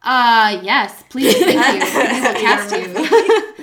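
hammed-up eager yes-please, laughing; eager studio. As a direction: play Disney grabbing her gleefully. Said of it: "Disney was like".

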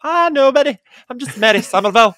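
high, loud coy little voice; mock modest character. Older women quoting Mary Somerville, coy and high. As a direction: play it falsely modest and coy. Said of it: "you could hear when they go".

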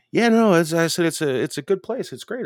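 high, loud mock cheer; mock enthusiastic. Narrator answering his friend Money, mock enthusiastic.

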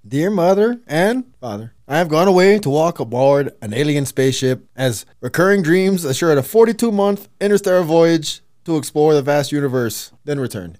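put-on loud reading in a failing Canadian accent; loud, half-lost Canadian accent; Canadian accent attempt. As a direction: read the note like a Canadian. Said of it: "so it actually sounded Canadian".

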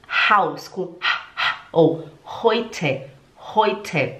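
Haus and heute with a breathed H; low, slow demo of H in Haus and heute; aspirated H. Shown as a demonstration. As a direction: puff the H of Haus and heute, slowly. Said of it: "fazer o som de H".

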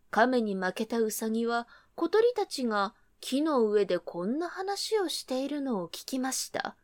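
ordinary delivery, nothing marked

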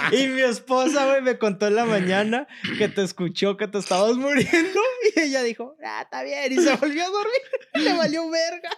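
high-pitched telling through laughter; laughing. Real, not acted. Friend telling how his wife ignored the choking, laughing. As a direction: tell it barely holding back the laughs.

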